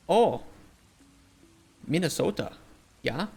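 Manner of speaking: sing-song Upper Midwest accent; Yooper accent. Shown as a demonstration. Streamer attempting a Yooper for chat, Upper Midwest accent. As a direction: try a Minnesota-Yooper accent. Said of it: "I don't know if I can do, like, a Yooper".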